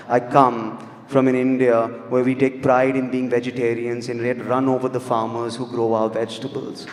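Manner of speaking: normal talking voice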